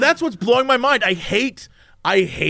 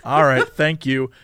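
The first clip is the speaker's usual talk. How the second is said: dry sarcastic; 8 semitones lower than usual. Retold or imitated. Speaker answering the security agent, low dry sarcasm. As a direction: let the sarcasm drip, low. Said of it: "And I'm like".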